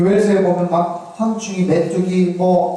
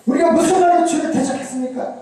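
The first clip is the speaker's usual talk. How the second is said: much higher, protesting question; quarrelsome retort; 9 semitones higher than usual. Acted. Israel disputing with God, high-pitched and quarrelsome. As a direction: argue back, indignantly denying it. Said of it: "막 싸우는 똑같은 거예요".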